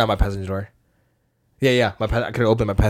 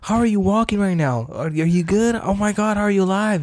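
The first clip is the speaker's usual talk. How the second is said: imitating stunned onlookers, much higher pitch; shocked bystanders; 10 semitones higher than usual. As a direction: voice the disbelieving crowd, pitched way up. Bystanders react to crash survivor.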